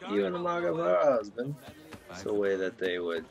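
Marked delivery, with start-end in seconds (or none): 0.1-1.6 high mock guard taunt; imitating the guard. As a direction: parrot the guard's jeer, pitched up. Streamer parroting the guard's taunt.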